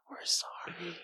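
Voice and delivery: slow, soft whisper; whispered apology. Real, not acted. Guest to the unanswered callers at the door, whispering. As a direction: whisper sorry to people who mustn't hear. Apology not meant to be heard.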